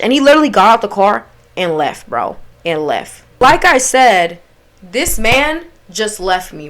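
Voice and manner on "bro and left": usual speaking voice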